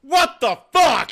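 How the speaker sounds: high, very loud, drawn-out disbelief; incredulous shout. Retold or imitated. Reacting to the Johnstown-born man, incredulous shout. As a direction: explode in comic disbelief. Teller's outburst at fake accent.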